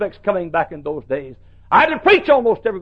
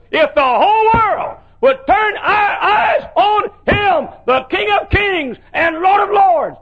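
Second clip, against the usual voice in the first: much higher, slow, shouted climax; preaching shout; 6 dB louder, slower than usual. Acted. Preacher calling the world to Jesus, soaring shout. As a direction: soar up and cry it out.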